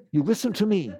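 half-joking stern teacher; mock scolding. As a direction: lightly scold the audience. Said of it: "I'm scolding you".

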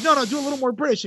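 quick, high director's note; George. Acted. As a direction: rattle off a mock director's correction. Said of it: "he's like".

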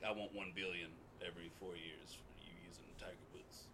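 hushed whisper of a greedy demand. Retold or imitated. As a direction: breathe it out in a whisper. Tiger Woods demands money.

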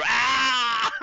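high hammed-up cry of fake pain; dramatic tauntaun wail. Host voices the dramatic tauntaun, high hammed-up wail. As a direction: overact a creature's fake injury. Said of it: "dude, I barely touched you".

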